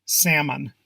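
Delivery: Salmon said SAM-un; silent L. Shown as a demonstration. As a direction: leave the L out of Salmon. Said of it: "the L is silent".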